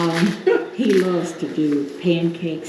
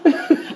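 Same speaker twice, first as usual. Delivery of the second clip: burst of laughter; 11 semitones higher, 6 dB louder than usual. Real, not acted. Laughing at brother's story.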